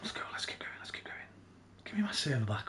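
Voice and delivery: very soft voiceless whisper; hushed, barely audible whisper; whispered urging. Acted. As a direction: urge someone on in a whisper. Muttered reminiscence of a rave night.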